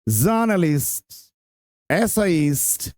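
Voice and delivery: जर्नलिस्ट, एसेइस्ट said slowly and carefully syllable by syllable; जर्नलिस्ट and एसेइस्ट spoken slowly, each syllable clear; slow pronunciation model. Shown as a demonstration. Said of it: "इसका pronunciation यहाँ".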